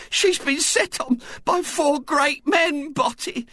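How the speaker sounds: high, slow, tearful matron's voice; tearful Missus Bott. A narrator's reading. Missus Bott tearfully telling her husband of the kidnapping. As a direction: quaver it out like a frightened mother. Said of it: "she was saying tearfully".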